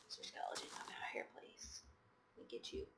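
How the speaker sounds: very soft, whispered coaxing. Pretend. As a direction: whisper as if luring out an enemy. Coaxing the skeleton out.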